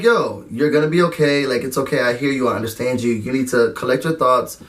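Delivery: calm her down gently; a hint of a soothing friend; reassuring. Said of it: "she was like".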